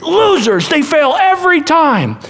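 high mocking shout from the crowd. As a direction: sneer at them like a mocking crowd. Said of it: "the crowds that mock".

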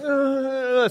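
put-on high, drawn-out baby "uh"; imitated toddler noise. Dad imitating his eighteen-month-old offered a spoon, wordless whine. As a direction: mimic a baby's wordless protest. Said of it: "and he was like".

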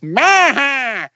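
high, stretched mock yell; imitated yell. Uncle mimics nephew doing the buck teeth face, mock yell. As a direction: put on the buck-teeth catchphrase cry. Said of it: "he'd go around yelling".

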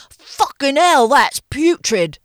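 loud, high, slow disgusted chef; drawn-out hammed-up British outrage; Gordon Ramsay impression. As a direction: condemn the food in a bad English accent. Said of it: "do his Gordon Ramsay impression".

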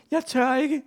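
high-pitched reluctant wail; frightened cry. A narrator's reading. Gym teacher refuses being locked in, high frightened cry. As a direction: cry out in a small, panicky high voice. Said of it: "råbte gymnastiklæreren".

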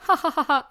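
high, loud mock laugh; imitated laugh. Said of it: "then she went like".

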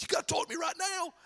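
imitating a panicked friend; mock frantic friend. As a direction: plead in a high, mock-desperate voice. Acting out a frantic friend's text.

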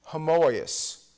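homoios, said slowly and clearly as ho-moy-os; Greek word said out. Shown as a demonstration. Preacher teaching the Greek word to the congregation, clear and careful. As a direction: sound out homoios for the class. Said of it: "In the Greek word".

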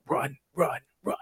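hushed, whispery movie-character imitation; imitated hushed warning. Retelling a girl leaning over in a horror scene, hushed. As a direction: whisper it in short, separated words.

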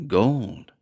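understated shaken wonder; shaky voice. A narrator's reading. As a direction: say it with a slight tremor. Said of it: "his voice shaky".

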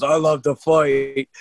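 slow, lightly voiced tough-guy promo line; a hint of a menacing wrestler savouring the words; imitating Fit Finley. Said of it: "in his promos where he goes".

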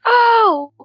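loud high startled oh. Acted. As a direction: jump at a sudden voice in your head. Telepathic voice suddenly heard.

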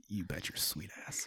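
very soft whispered reply; conspiratorial whisper. Retold or imitated. Answering fellow exhibitors about meeting up, conspiratorial whisper. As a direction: whisper back as if in on it.

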